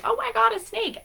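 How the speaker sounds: high mock-scared shriek; imitating a startled squeal at a snake. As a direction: squeal it like a jumpy runner. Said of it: "I wasn't like".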